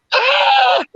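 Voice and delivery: high, loud yelp of a scream; performed scream of being hit; demonstrated scream. Shown as a demonstration. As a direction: let out a sudden high-pitched "Ah!". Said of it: "I'm gonna scream".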